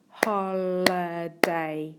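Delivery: "Holiday" drawn out slowly, syllable by syllable; slow, syllable-by-syllable "Holiday" for a spelling lesson; broken into syllables. Shown as a demonstration. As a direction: stretch "Holiday" into three separate beats, as if clapping it. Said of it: "break it into syllables".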